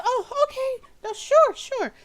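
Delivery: a nervous hostess piping up; Myrtle's high voice. Acted. Game master voices Myrtle asking why the party came, high. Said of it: "She says".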